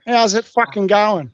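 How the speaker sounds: slow broad Aussie "How's it fucking going?"; Australian accent. Shown as a demonstration. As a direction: draw it out like an Australian. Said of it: "Can you say that".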